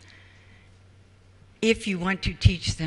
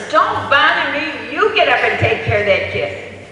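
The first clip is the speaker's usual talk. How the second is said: louder, snappish husband shoving it back; a husband refusing, voice raised; irritated husband; 5 semitones higher, 5 dB louder than usual. Retold or imitated. Husband to wife over crying baby, irritated and louder.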